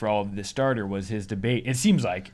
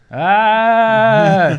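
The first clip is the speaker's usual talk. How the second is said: long, loud, high "aah"; loud held yell in imitation; imitated yell; 8 semitones higher, 10 dB louder than usual. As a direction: yell one sustained note like a sound healer. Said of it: "a guy who's yelling at a woman's stomach".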